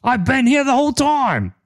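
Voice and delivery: high, indignant NPC voice; offended character voice. Acted. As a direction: act wounded at being forgotten. GM voices Zeke protesting.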